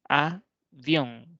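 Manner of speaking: «avión» split in two, a - vión, with a pause; sílaba por sílaba. Shown as a demonstration. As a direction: say «avión» as two separate syllables. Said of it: "son palabras bisílabas que están compuestas por dos sílabas". («avión» separado: a - vión)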